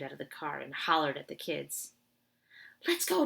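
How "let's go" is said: high, loud urgent call; yelled. Acted. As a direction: shout it to get them moving. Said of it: "Josh yelled".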